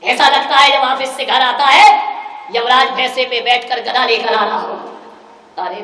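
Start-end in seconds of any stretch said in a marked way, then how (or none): 0.0-4.7 scornful rant. Retold or imitated. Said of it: "बोले".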